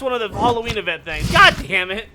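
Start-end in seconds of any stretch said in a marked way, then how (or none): 1.3-2.1 loud annoyed curse; frustrated curse. Real, not acted. After dying to an alligator, loud frustrated curse. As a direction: swear it out loud.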